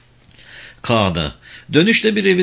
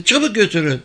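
high-pitched, insistent command; sharp order; 10 semitones higher, faster than usual. Acted. Old woman hurrying the children, high sharp order. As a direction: order them to clear it away.

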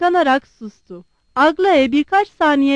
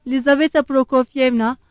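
plain reading voice

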